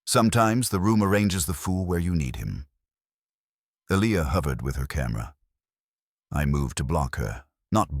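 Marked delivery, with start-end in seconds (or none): none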